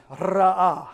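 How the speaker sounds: "Ra'ah" with a loose, trilled R; rolled R. Shown as a demonstration. Preacher proves his tongue got loose, trilling "Ra'ah". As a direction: let the tongue flutter on the R of "Ra'ah". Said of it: "my tongue got loose".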